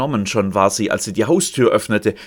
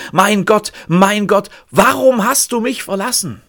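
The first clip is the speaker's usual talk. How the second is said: high, loud cry of despair; a raised, pleading outcry; anguished cry; 8 semitones higher, 4 dB louder than usual. Acted. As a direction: call out to God in anguish. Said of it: "der Schrei".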